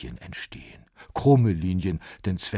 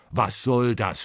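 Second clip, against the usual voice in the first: louder, sharp angry question; scolding; 5 semitones higher, 6 dB louder than usual. A narrator's reading. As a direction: Bach snapping at the mess. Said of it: "schimpft Bach".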